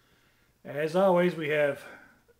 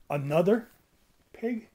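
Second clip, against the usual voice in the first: ordinary delivery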